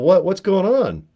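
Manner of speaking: high, slow alarmed question; startled what. Retold or imitated. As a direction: jump up in pitch, stretching it out in shock. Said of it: "I'm all".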